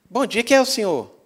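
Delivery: high, loud questioning of a stranger; lightly acted old lady sizing up a visitor; wary old lady. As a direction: challenge the unknown caller, mock-suspicious. Old lady greets unknown visitor.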